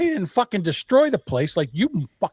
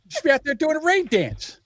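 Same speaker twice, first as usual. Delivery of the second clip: high, laughing through the line; laughing repeat; 10 semitones higher than usual. Real, not acted. Host repeating the rain dance joke, laughing higher. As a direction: say it again, giggling higher.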